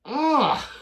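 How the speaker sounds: high loud gleeful cry; loud excited ah; delighted yelp. Real, not acted. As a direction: yelp with amusement. Delighted reaction to word.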